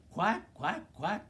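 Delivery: high, loud, short quacks; duck quacking. A narrator's reading. Reader to children as the duck, loud quacking. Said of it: "called the duck".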